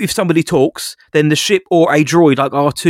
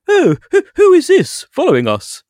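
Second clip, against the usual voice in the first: lifted way high, mocking posh British accent; 13 semitones higher than usual. Podcaster mocks the alien's dialogue in hammed-up, much higher posh British accent. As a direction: parody the stiff, prim posh British line.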